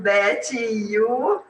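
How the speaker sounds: "that e you" said slowly, word by word; separated words. Shown as a demonstration. Student admits she still speaks separately, "that e you" unlinked. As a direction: keep "that" and "you" apart, laughing after.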